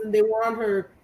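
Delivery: normal talking voice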